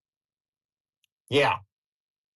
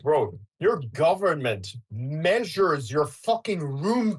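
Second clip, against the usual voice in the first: slow mock lecture; 4 semitones higher, slower than usual. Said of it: "I'm like".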